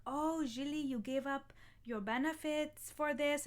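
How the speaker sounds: lightly put-on German accent. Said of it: "That's a terrible German accent".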